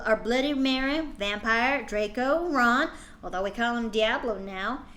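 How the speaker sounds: ordinary talk, nothing marked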